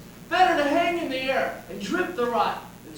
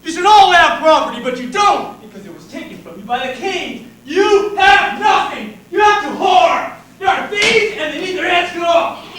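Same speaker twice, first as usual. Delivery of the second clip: inciting shout; 4 semitones higher, 8 dB louder than usual. Acted. Inciting the crowd.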